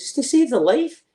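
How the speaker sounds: pleading the case hard; insistent protest. Retold or imitated. Soldier arguing for the radar, loud protest. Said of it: "I says".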